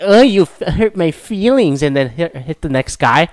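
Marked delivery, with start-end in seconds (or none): 0.0-1.8 higher, slow, hammed-up hurt whine; mock offended whine. Mocking offended American ballplayers, in a slow, higher hammed-up whine. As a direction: whine like a wounded crybaby.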